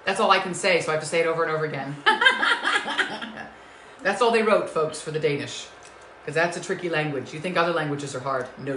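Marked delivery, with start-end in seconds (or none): 2.0-3.5 high laugh. Real, not acted. Laughs at repeating herself.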